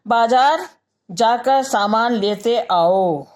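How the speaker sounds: slow, drawn-out order to go to the market; slow command. Shown as a demonstration. Teacher demonstrating a command to students, slow and drawn out. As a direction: stretch out each word of the order. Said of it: "अब ये देखिए".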